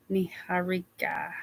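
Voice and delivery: Niharika whispered under the breath, practising; whispered retry. Shown as a demonstration. Host quietly retrying a viewer's name in a whisper. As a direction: breathe out Niharika to yourself, trying again. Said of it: "I still don't think I'm getting it".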